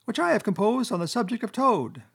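high-pitched speechifying Chief Weasel; high squeaky voice. A narrator's reading. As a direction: address the banquet in a high squeak. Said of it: "began in a high, squeaky voice".

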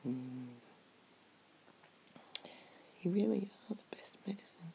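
soft slow caring whisper; ASMR whisper. Acted. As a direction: whisper gently at the bedside. Soft bedside comforting whisper.

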